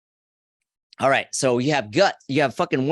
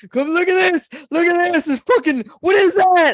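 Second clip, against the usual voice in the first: much higher, louder mock panic; freaked out; 8 dB louder than usual. Friend calling girlfriend to bathroom, in shrill mock panic. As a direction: shriek for the girlfriend to come look. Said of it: "called his girlfriend in the bathroom".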